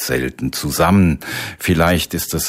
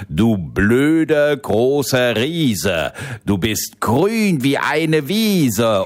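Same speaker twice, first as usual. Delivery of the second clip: slow, higher taunting rhyme; a boy slowly taunting the giant in verse; mocking rhyme; 6 semitones higher, slower than usual. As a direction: spell out the insult slowly to the giant.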